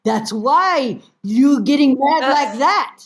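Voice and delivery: slow, high-pitched telling-off; scolding mother. Retold or imitated. Mother scolds her daughter back.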